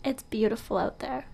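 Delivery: normal talking voice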